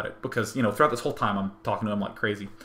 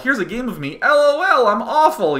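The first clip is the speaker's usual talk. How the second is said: high, loud, goofy self-mockery; exaggerated high-pitched mock cheer at own failure; self-mocking show voice; 12 semitones higher, 8 dB louder than usual. Gamer hosting own dailies about a game of theirs, high loud self-mockery.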